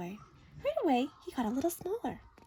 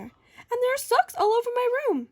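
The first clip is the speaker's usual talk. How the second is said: put-on high, loud complaining child; whiny little girl; 10 semitones higher, 5 dB louder than usual. Girl complaining to her mother, high and whiny.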